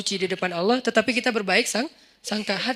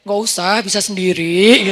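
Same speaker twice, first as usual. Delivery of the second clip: drawn-out mock proud refusal; put-on too-proud-for-help voice; feigned pride; 3 semitones lower, 5 dB louder, slower than usual. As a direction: brush off the offer, stretching the last word. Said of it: "Saya pura-pura gengsi gitu".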